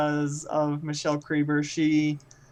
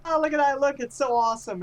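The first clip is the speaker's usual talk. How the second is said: excited fan; 9 semitones higher than usual. Retold or imitated. Retelling fans who saw the cape, gushing higher. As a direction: gush like an admiring stranger. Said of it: "people who are like".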